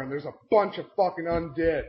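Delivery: ordinary talk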